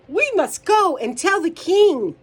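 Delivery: high, loud and insistent; animated call to action for kids; urgent storybook plea. A narrator's reading. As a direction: press the warning on the others. Hen urgently warns in story.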